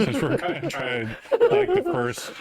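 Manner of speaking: words squeezed out through laughter; laughing. Real, not acted. Explaining the stream to viewers while cracking up, laughing. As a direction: laugh your way through the line.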